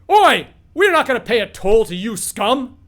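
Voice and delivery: loud, high yell into the distance; guard yelling. Acted. Coach guard yelling at distant bandits. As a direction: bark it at someone far away. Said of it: "You hear the guard on top call out".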